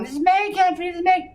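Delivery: high mocking squeal of a weakling; whiny little bitch. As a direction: mimic a wimp running around, very high-pitched. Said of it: "He's like a little bitch running around going".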